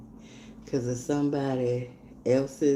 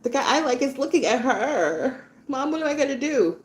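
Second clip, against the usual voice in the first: put-on higher, whining teenage girl; upset daughter; 8 semitones higher than usual. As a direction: plead with mom, upset. Teen daughter pleading to mom.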